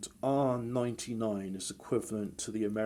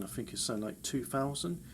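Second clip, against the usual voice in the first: ordinary delivery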